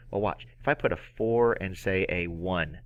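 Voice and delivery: usual speaking voice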